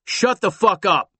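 loud, harsh command; angry cop. Acted. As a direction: bark at him. Cop barks at Tom.

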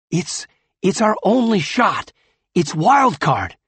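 urgent pleading. Acted. Finn argues with Tachyon.

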